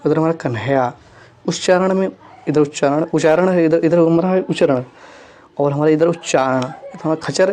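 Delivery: normal talking voice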